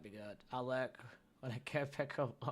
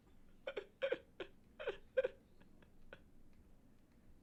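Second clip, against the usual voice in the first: squeaky breathless laugh; real high-pitched giggling fit; high squealing laugh. Real, not acted. Laughs after his voice attempt.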